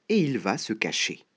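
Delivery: ordinary delivery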